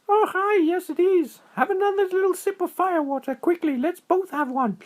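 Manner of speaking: very high, excited little squirrel voice; high squeaky critter voice. A narrator's reading. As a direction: chirp it out like a tiny squirrel. Squirrel voice held.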